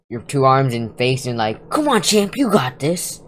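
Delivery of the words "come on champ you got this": high-pitched cheering-on; mock pep talk. Gamer joking about getting punched, acting a mock cheerleader. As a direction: cheer on a champ in a much higher voice.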